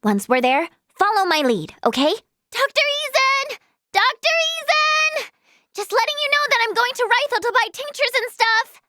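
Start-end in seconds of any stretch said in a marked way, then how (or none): 2.5-5.3 high, sustained sing-out of a name; calling out. Acted. Calling out to the doctor. 5.8-8.8 high-pitched announcement to another room; calling out. Acted. Calling news across to the doctor.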